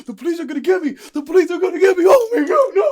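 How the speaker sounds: frantic high-pitched, very loud wail; imitating a hysterical fugitive; panicked screaming. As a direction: scream like the police are grabbing you. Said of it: "He sounded just like that".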